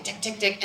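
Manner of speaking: soft, rapid voiceless ticks; imitated ticking. Storyteller mimics the elevator climbing back up, soft rapid ticking. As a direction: click it out evenly like machinery.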